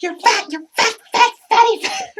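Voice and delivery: high, loud hammed-up insults; mock name-calling fight. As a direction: squabble childishly, pitch shooting up. Acted squabble over the books.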